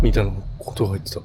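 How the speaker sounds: usual speaking voice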